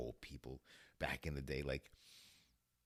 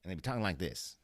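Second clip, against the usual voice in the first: rapid-fire fifties patter, raised voice; fast old-movie talk; 4 semitones higher, 5 dB louder, much faster than usual. Shown as a demonstration. Showing how fifties people talked, fast old-movie delivery. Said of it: "Talking like a fast nineteen fifties person".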